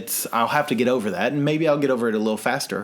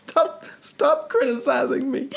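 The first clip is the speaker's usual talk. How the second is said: laughing protest. Real, not acted.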